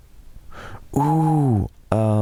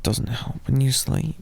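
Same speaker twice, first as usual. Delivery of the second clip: ordinary delivery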